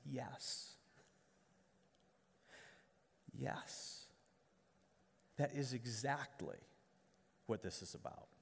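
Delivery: soft near-whisper as Jesus, gently affirming; hushed reply. Acted. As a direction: breathe the yes like a quiet promise. Jesus answers the questioning believer.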